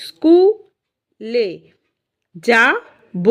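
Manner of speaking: "স্কুলে যাব" very slow, a gap after each syllable. Shown as a demonstration. Teacher showing where the gap comes, syllable by syllable. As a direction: break "স্কুলে যাব" into stressed beats, স্কু-লে-যা-ব. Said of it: "একটা কিন্তু gap আসে".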